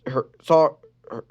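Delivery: plain reading voice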